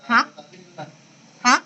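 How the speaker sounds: short rising "Hah?"; startled huh. Shown as a demonstration. Teacher asking who will try, startled rising "Hah?". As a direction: demo a surprised, questioning "Hah?". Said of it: "Ini kayak orang".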